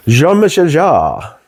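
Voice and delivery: mock French accent. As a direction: drawl the name out in a hammed-up French accent. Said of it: "Don't know what accent that was".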